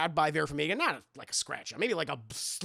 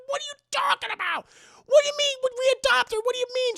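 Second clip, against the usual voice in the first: much higher, incredulous questions; baffled husband; 6 dB louder, faster than usual. Retold or imitated. Husband baffled by his wife's accusation, high incredulous voice. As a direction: squeak out total disbelief.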